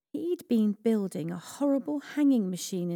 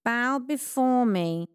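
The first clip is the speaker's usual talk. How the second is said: slow, higher villain's command; slow menacing command from the villain; horrible Haman commanding; 5 semitones higher, 4 dB louder, slower than usual. A narrator's reading. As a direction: lord it over them, slowly. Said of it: "horrible Haman commanded".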